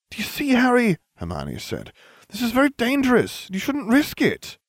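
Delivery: put-on high, earnest warning girl; worried schoolgirl pleading; Hermione's voice. A narrator's reading. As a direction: warn him seriously. Hermione warns.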